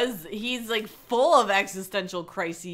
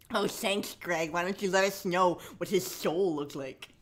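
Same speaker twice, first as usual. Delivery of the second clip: ordinary talk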